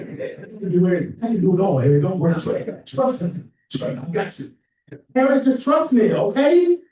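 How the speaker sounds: imitating a friend who won't take no; eager Ron. Ron insisting to Harry he can be publicist, excited. Ron's eager reassurance, retold.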